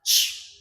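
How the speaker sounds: an isolated, voiceless "ch"; "ch" alone as a phonics model; CH sound demo. Shown as a demonstration. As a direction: give just the "ch" sound, no vowel. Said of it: "The first one is".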